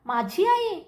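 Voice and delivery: a touch of disbelief, pitch lifted; shocked. A narrator's reading. Daughter learns of her mother's part, mildly shocked. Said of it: "अन्वीला धक्का बसला".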